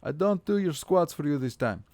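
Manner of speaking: a hammed-up angry Russian accent telling off a cheeky viewer. Streamer scolding chat member Sapo over a redemption, in a mock-angry Russian accent. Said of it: "You get five minutes of angry fucking Russian accent".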